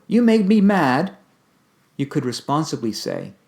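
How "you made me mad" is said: high, loud, slow accusing; exasperated blaming outburst; angry blame. Shown as a demonstration. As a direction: snap the blame out. Example of blaming quote.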